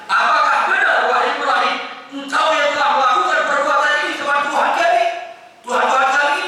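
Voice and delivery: high, urgent accusing question; accusing interrogation. Acted. Idol worshippers questioning Ibrahim, accusing.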